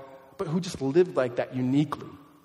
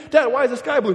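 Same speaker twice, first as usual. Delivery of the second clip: put-on higher, louder kid asking Dad; high-pitched mock child question; pestering child; 8 semitones higher, 6 dB louder than usual. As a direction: voice a curious child with a raised pitch. Preacher voices child pestering dad.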